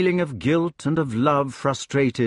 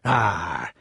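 low, drawn-out rustic "Ah"; sarcastic; 4 semitones lower than usual. A narrator's reading. Hedgehog scoffing at the committee, sarcastic. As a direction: scoff in a long syllable. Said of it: "he said sarcastically".

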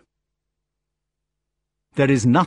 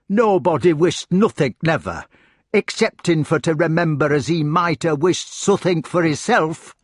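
higher-pitched broad rustic dialect, scornful; truculent hedgehog; 6 semitones higher, 4 dB louder than usual. Acted. Hedgehog retorting to the magician, truculent. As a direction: throw it back in his face.

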